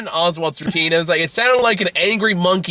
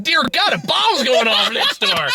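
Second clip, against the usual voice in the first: high, slow, laughing dread; laughing mock horror; 7 semitones higher than usual. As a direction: act horrified while laughing. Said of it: "'cause, like".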